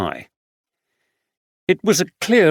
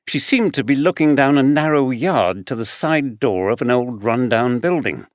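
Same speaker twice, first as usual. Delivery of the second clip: plain reading voice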